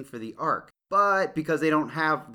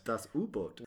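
put-on softer, hammy German accent on Das U-Boot; 7 dB quieter than usual.

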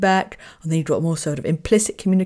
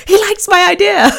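much higher and louder, half laughing; laughing punchline; 13 semitones higher, 7 dB louder than usual. Real, not acted. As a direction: squeal it out through laughter.

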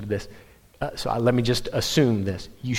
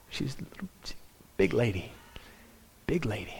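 confidential whisper, much softer; hushed aside; 9 dB quieter than usual. Acted. Preacher telling the congregation about a large lady, hushed aside. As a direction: whisper it behind your hand.

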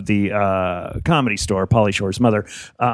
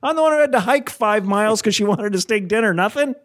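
indignant retort; 10 semitones higher than usual. Retold or imitated. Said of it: "I'm like".

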